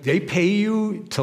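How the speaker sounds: high, slow, puzzled question; mock incredulity. Preacher questioning garbage can cleaner, retold incredulity. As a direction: draw it out in disbelief. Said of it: "I'm going like".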